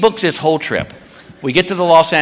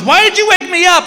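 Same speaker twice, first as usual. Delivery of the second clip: annoyed wife; 13 semitones higher than usual. Retold or imitated.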